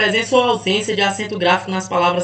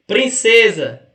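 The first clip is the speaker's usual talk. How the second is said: 1 slow reading; 5 dB louder, much slower than usual. Shown as a demonstration. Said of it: "reading quiz word slowly".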